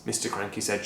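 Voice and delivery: ordinary delivery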